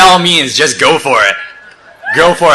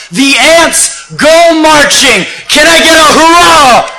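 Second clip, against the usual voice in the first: high, loud climactic call; rallying shout; 12 semitones higher, 4 dB louder, slower than usual. Real, not acted. Speaker asking the crowd for a hurrah, rallying shout. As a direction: shout it out to raise a cheer.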